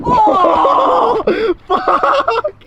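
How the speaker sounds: very high shout through laughter; laughing shout. Real, not acted. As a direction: crack up and yell it out. Laughing at a mishap.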